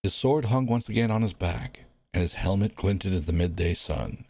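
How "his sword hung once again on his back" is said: usual speaking voice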